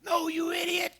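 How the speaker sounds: No, you idiot shouted high; mock yelling. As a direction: scream at the dog like a frustrated hunter. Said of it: "yelling and screaming".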